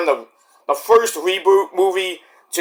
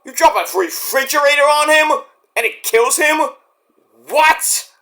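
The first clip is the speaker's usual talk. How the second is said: incredulous; 9 semitones higher than usual. Real, not acted. Incredulous at stupid ending.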